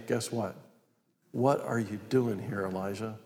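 very soft, low voice; low whisper of God. Acted. God quietly questioning Elijah at the cave, hushed. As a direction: drop right down to a hushed question. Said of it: "there came a voice to him saying".

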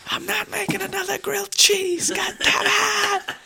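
high, loud exasperated rant; frustrated outburst. Real, not acted. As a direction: vent it in a high strained voice. Frustrated outburst about food at home.

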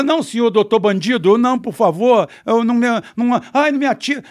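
high, loud, hammed-up begging; mock pleading. Victim begs the bandit not to shoot, mock pleading. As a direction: beg frantically like a cowering victim, exaggerated. Encena vítima implorando ao bandido.

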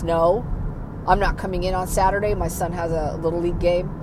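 fast, matter-of-fact refusal to the boss; brisk refusal. Retold or imitated. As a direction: rattle it off, no apology. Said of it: "tell your boss".

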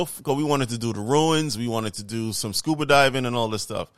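ordinary talk

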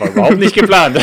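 high, loud, laughing through the words; laughing sarcasm. Real, not acted. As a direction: ironic line burst out laughing. Ironic remark, laughing. (lachend und ironisch)

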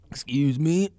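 strained voice after choking; catching breath after water went down wrong. Real, not acted. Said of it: "water went down the wrong pipe".

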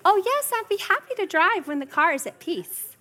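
mock-sweet, calmly unbothered; sweetly cheerful. Mom sweetly answering kids who beg her to drive. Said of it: "And then they, "Mom, go!".

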